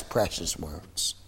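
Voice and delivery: slow, low and very soft; drawn-out, hushed awe; hushed reverence. Real, not acted. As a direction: savour the words almost under your breath. Savouring the publican's prayer.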